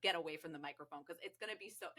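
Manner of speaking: ordinary talk, nothing marked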